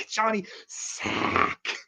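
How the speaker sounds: very slow whispered name. Pretend. Whispering the joke name for J P, slow whisper. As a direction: breathe the name out slowly.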